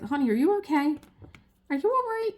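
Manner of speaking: high-pitched imitation of a worried old lady; concerned old lady. Old lady across the waiting room to patient, concerned. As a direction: voice a fussing stranger, rising high. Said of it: "The old lady sitting across from me".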